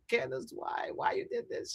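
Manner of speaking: telling Candace why she did this, in a hushed whisper. Retold or imitated. Retold plea to Candace.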